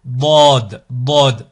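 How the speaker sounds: Dod with a heavy, dark emphatic D; firm backed D on Dod; emphatic, grave D. Shown as a demonstration. Teacher demonstrating the emphatic letter Dod, heavy and dark. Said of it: "Son emphatique, son grave".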